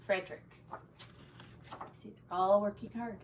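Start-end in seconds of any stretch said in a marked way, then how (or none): 1.9-3.2 soft, hushed comment; whispered aside. Real, not acted. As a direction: lean in and whisper. Whispered aside about picture.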